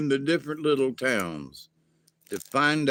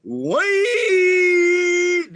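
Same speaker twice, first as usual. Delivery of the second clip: shout; 7 dB louder than usual. Acted. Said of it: "they jumped out".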